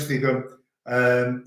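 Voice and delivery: ordinary talk, nothing marked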